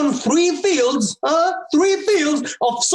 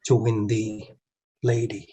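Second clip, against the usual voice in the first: lower, softer, almost tender; soft turn; 6 dB quieter than usual. Acted. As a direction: drop the voice to win her. Quiet drop after boast.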